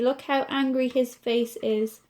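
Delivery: ordinary delivery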